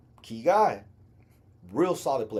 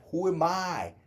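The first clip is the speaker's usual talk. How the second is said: usual speaking voice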